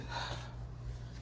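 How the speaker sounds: long weary exhale; a drawn-out exhausted sigh; tired sigh. Acted. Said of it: "This is where Issei says".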